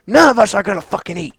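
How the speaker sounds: ordinary talk, nothing marked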